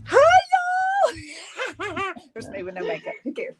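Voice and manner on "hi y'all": squealed greeting; excited hi. Real, not acted. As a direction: shout a happy hello. Calling out to viewers.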